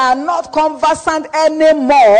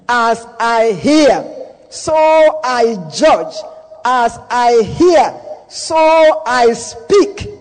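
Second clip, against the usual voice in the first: slow emphatic scripture; slower than usual. Real, not acted. Preacher drawls scripture for emphasis.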